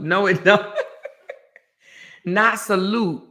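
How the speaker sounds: laughing while correcting; chuckling through the words. Real, not acted. Laughing at friend's wrong answer.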